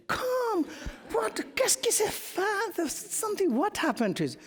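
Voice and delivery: put-on very high, bright old lady voice calling him over; high-pitched granny coaxing; mock old lady. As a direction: squeal like an excited old French lady. Old ladies pulling him to dance.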